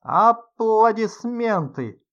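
drawn-out, loud and high; loud announcer call. A narrator's reading. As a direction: stretch it like a showman calling for applause. Host calls for applause. (громкий выкрик конферансье)

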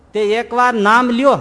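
put-on urging the old woman loudly; loud plea. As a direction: press her to say it once.

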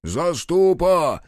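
long, high drawn-out holler; distant calling out. A narrator's reading. Frol hunting for the guardian in darkness, calling out. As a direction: call him through the dark, stretching the vowels. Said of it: "донеслось из промороженной темноты".

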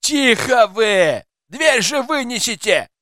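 high, drawn-out wail through a door; moaning complaint. A narrator's reading. Man inside begging them to spare the door, moaning. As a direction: wail in protest, slowly. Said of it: "застонал голос за дверью".

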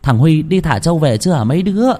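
grandma voice, counting heads and wondering; grandma counting children. Acted. Grandma counts her children at dinner, puzzled grandmother voice.

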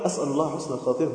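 soft, quick pious murmur; humble murmur. Retold or imitated. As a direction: mutter it modestly, brushing off praise. Quoting a humble pious man. (همهمة متواضعة سريعة)

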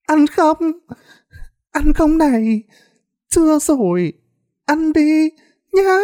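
very high, crazed madwoman voice; giggling madwoman. A narrator's reading. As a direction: offer it in a high, gleeful, demented squeal. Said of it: "Ấy bà lại cười lên khanh khách". (giọng bà điên the thé, khoái chí)

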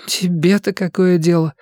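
a hint of a moody girl; gloomy retort. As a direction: snap it back sullenly. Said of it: "сумрачно спросила она".

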